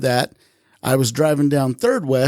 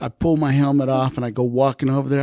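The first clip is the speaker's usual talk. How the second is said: ordinary delivery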